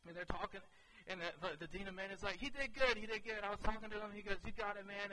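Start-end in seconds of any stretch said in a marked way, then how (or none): 2.4-3.4 high, loud retold praise; encouraging. Dean of Men praising student to office staff, encouraging. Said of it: "the Dean of Men was encouraging me". 4.5-5.1 raised, louder retold cheer; encouraging. Dean of Men cheering on struggling student, encouraging. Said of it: "the Dean of Men was encouraging me".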